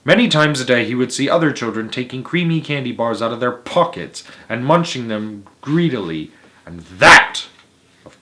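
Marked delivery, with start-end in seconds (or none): none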